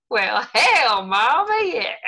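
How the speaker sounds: loud, high Texan exclamation. Pretend. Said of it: "come on, [name], give us a line".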